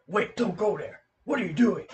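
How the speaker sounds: a hint of Solid Snake warning a comrade off; Solid Snake impression. Imitating Solid Snake stopping someone, mildly voiced. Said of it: "Solid Snake is like".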